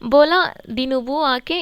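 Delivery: बोला दिनुबू said slowly with a rise on बोला; name spelled out slowly. Shown as a demonstration. Teacher gives students the president's name, slow and clear. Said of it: "नाम उनका याद रखो".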